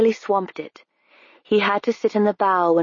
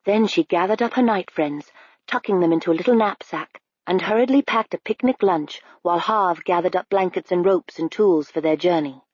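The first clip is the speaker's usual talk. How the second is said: ordinary delivery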